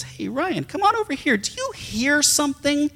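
a friend's high, curious call; calling friend John. Retold or imitated. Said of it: "John says".

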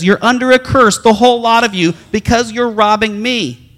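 loud, high preaching of God's rebuke; loud scripture quote. Retold or imitated. Pastor to congregation, quoting God's rebuke loudly. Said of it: "His word actually says".

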